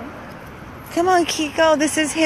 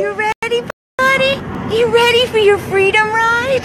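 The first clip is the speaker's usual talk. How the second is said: high, bright, louder coaxing to the dog; 10 semitones higher, 3 dB louder than usual. Real, not acted. Rescuer hypes the dog for his freedom ride in high baby talk.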